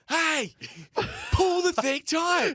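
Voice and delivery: high, loud, drawn-out call upward; retold yelling. Exhausted climber to partner forgetting the rope, yelling. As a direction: re-enact hollering up a cliff. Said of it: "and I'm yelling".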